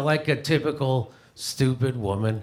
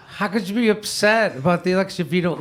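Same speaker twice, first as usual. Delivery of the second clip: ordinary talk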